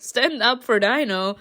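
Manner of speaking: usual speaking voice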